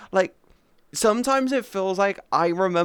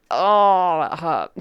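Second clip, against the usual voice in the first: ordinary talk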